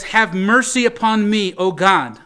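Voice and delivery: praying David's plea with force; pleading. Acted. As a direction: cry out to God for mercy. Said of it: "it's pleading for the mercy of God".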